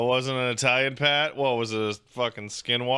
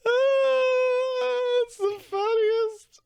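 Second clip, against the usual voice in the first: squeaky words through laughter, much higher; laughing high voice; much slower than usual. Real, not acted. Streamer telling chat the tweet is the funniest, through high laughter. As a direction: squeeze it out mid-laugh.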